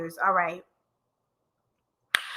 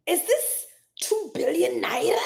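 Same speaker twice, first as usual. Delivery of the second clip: whispered, baffled question; hushed confusion over a donation; 6 semitones higher than usual. Real, not acted. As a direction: ask it under your breath. Puzzling over a donation currency.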